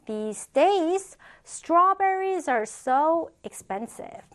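slow, drawn-out "strawberries are so expensive"; slow model reading. Shown as a demonstration. Teacher models sentence slowly, then repeats.

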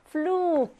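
"플룩" with a long drawn-out u; long vowel. Shown as a demonstration. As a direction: stretch the oo in "플룩". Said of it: "역시 길게".

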